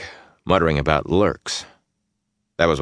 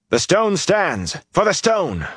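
high, drawn-out battle cry; pitched-up shout at moderate volume; rallying cry; 12 semitones higher, slower than usual. A narrator's reading. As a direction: call out a war cry to rally the men. Hero shouts war cry to rally men.

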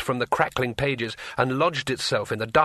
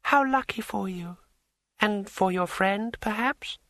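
smooth dryad voice; 9 semitones higher than usual. A narrator's reading. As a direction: glide through it smoothly. Dryad keeps speaking.

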